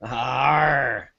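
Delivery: mock pirate arr; pirate growl. Player drops into a pirate voice, mock pirate growl. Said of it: "Now we've gone pirate".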